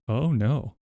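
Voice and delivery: ordinary talk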